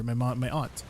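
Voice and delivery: normal talking voice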